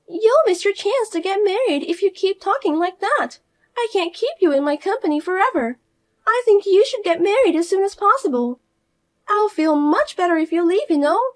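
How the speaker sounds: high, loud, preachy; condescending sister-in-law. Acted. Fiona pushing her sister-in-law to marry, high and preachy. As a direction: talk down to her like a child.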